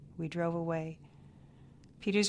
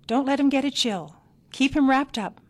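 higher, louder bossy order; sharp snap; 7 semitones higher, 9 dB louder than usual. A narrator's reading. Grandmother scolds the mother about the baby, sharp snap. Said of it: "Miney snapped".

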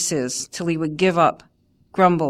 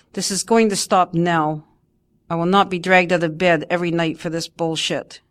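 ordinary delivery, nothing marked

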